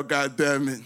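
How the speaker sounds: normal talking voice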